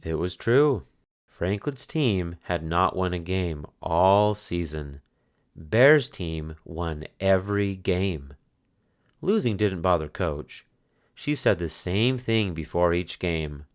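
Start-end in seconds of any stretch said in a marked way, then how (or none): none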